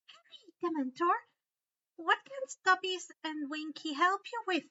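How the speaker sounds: high, eager elf voice; squeaky helpful house elf. A narrator's reading. Dobby greets Harry, high elf voice. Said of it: "Dobby asked".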